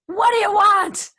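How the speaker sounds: loud, high, drawn-out challenge; acted-out indignant yell; angry demand. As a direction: hurl the question at an unwelcome visitor. Hostile patient confronts religious visitor.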